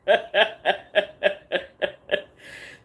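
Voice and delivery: long loud laughing fit; loud laughter. Real, not acted. Laughing at her own story, loud laughter. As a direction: break up laughing.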